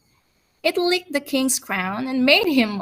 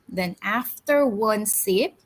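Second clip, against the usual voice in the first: ordinary delivery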